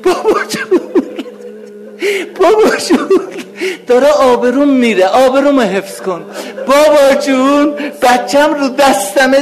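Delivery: begging desperately for help, voice breaking upward; weeping supplicant's prayer; tearful plea. Acted. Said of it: "چی بگی؟".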